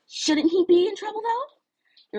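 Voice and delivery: put-on girl's voice, pissed. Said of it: "she sounded pissed".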